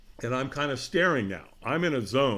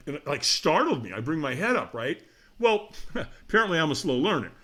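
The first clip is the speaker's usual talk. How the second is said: ordinary talk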